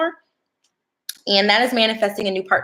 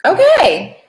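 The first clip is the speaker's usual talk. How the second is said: high loud astonished okay; surprised; 10 semitones higher, 8 dB louder than usual. Real, not acted. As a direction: react to a shocking card. Surprised at drawn cards.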